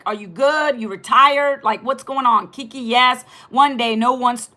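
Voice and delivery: ordinary talk, nothing marked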